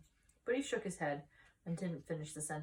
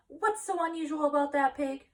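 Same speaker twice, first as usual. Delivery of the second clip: startled question; 9 semitones higher, 7 dB louder than usual. Acted. Wife questions husband.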